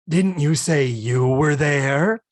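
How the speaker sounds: slow, pointed deadpan question; Isabel Sanford impression. Louise asking Lillian, slow and dry. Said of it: "nailing your Isabel Sanford".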